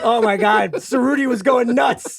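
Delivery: high, giddy self-congratulation; mock bragging. Mocking co-host's story by bragging about himself, playful crowing. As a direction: crow about yourself with laughter in it.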